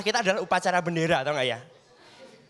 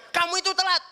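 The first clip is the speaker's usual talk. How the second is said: a high-pitched mock senior telling off a junior; hammed-up telling-off of a late freshman; scolding senior; 8 semitones higher than usual. Senior scolding the junior at orientation, high mock telling-off.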